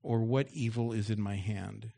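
loud preaching read of the story; loud scripture reading. Acted. Pastor reads David's taunt aloud to the congregation, loud and forceful. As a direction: boom the scripture out to the church.

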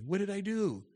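high, lightly whiny protest; a hint of a wounded whine; mock whine. Pastor mimicking the congregation's own whine, high. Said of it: "in good company".

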